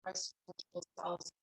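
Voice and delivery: soft, unhurried meditation voice with long pauses; guided meditation. Real, not acted. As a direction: guide them gently into stillness, leaving long silences. Leads a seated meditation.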